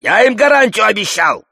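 high, urgent yelled order; commanding shout. A narrator's reading. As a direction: shout down a man with a gun. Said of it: "командует Чингачгуку". (командный крик на высокой ноте)

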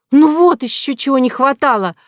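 high, loud gleeful cry; delighted exclamation. A narrator's reading. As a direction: relish every word. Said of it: "воскликнула Нинеля с таким наслаждением".

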